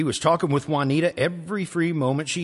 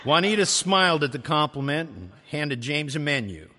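ordinary delivery

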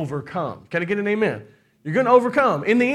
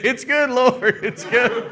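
higher, laughing through it; laughing surrender; 6 semitones higher, 4 dB louder, slower than usual. Retold or imitated. Mother admitting to the Lord it's good, laughing. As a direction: give in to God with a laugh. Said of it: "you gonna be saying".

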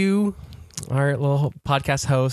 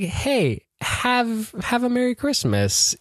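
mock cheery greeting; 10 semitones higher, slower than usual. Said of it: "it's just like".

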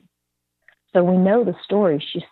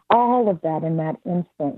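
ordinary talk, nothing marked

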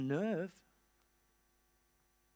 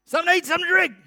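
bossy Elijah; 8 semitones higher, faster than usual. Retold or imitated. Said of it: "he's like".

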